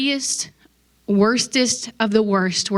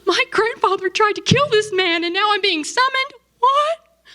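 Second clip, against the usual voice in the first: put-on much higher, frantic voice of a terrified young man; panicked disbelief; 12 semitones higher than usual. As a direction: squeak it out like you just got summoned by your enemy. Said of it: "he is freaking out. He's like".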